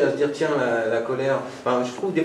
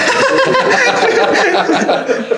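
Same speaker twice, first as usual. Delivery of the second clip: loud, high-pitched burst of laughter; big real laugh; 10 dB louder than usual. Real, not acted.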